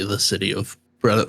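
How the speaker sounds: ordinary talk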